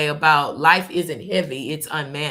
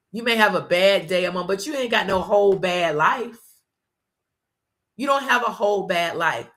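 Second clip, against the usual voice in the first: usual speaking voice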